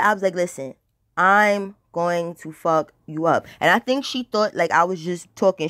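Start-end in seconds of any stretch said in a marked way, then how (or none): 0.3-3.5 slow, deliberate threat. Retold or imitated. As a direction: spell it out slowly, menacing. Repeats threat slowly to rival.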